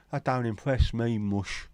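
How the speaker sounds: slow sung Cockney line, mush drawn out; Cockney accent. Retold or imitated. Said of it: "And sang in a Cockney accent, done by [name] and [name]".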